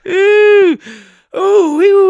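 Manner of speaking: high mock squeal; squealing ooh. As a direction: squeal it in a silly falsetto. Playing silly voice after rewind.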